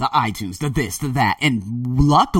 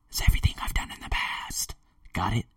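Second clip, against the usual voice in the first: hushed, breathy whisper; 3 semitones lower, 8 dB quieter than usual. Real, not acted. Podcaster whispering his point to listeners. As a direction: drop to a whisper to drive it home. Quiet emphasis on past work.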